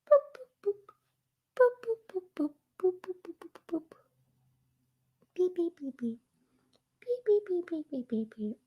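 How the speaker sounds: high little boops turning into beeps; playful boops. Pretend. Vlogger dabbing on makeup, high playful beeping. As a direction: dot out tiny high-pitched boops and beeps.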